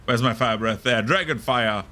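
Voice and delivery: normal talking voice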